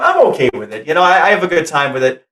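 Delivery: usual speaking voice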